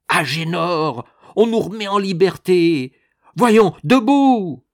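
loud, high, urgent yelling; shouting. A narrator's reading. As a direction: yell to wake him up. Wife shakes sleeping husband.

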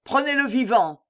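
high, urgent call; cried order. A narrator's reading. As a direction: call out the order to capture him. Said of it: "crièrent plusieurs voix". (ordre crié d'une voix aiguë)

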